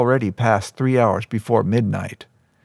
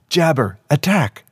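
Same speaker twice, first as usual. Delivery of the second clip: mild shout, higher-pitched command; angry shout; 5 semitones higher than usual. A narrator's reading. Red-faced boy orders his tiger hawk to attack, mild angry shout. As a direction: give the beast a heated order, pitched up. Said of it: "turned crimson and shouted again".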